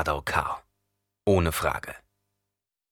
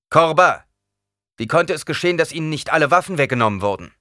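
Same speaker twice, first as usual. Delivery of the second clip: stern rebuke; 7 semitones higher, 6 dB louder than usual. A narrator's reading. Officer rebukes subordinate.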